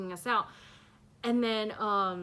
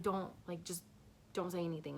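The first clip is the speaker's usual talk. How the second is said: softly imitating a brother's hush; retold hushing; 3 semitones lower, 9 dB quieter, faster than usual. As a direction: keep it down, mock-secretive shushing. Brother shushing the scared children.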